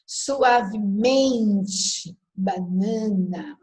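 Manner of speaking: suavemente and banana very slow, syllable by syllable; penultimate stress. Shown as a demonstration. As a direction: stretch the next-to-last syllable of suavemente and banana. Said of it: "penúltima sílaba". (suavemente, banana, bem devagar, penúltima sílaba forte)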